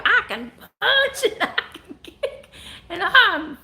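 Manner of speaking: high, laughing sketch voice; SNL "I'm fifty" bit. Acted. Host doing the SNL routine for viewers, high laughing mock brag. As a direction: brag it like the sketch lady, giggling. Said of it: "You know that Saturday Night Live thing?".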